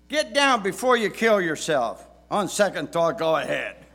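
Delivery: loud, high warning that gives up; scold, then give up like a dad; exasperated dad. Retold or imitated. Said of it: "words that many dads have said".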